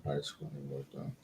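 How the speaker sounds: barely audible, low murmur; very soft, low thinking aloud; muttering to self. Real, not acted. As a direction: mumble it privately while thinking. Thinking over the question aloud.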